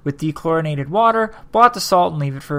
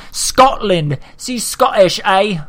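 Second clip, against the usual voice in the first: put-on mixed Scottish accent; 4 semitones higher, 4 dB louder, slower than usual. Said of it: "I think that was a Scottish accent and an Australian accent".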